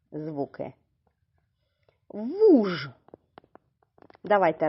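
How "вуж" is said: Вуж with a long held У; drawn-out word. Shown as a demonstration. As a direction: stretch out Вуж, louder. Stretches word for sound analysis.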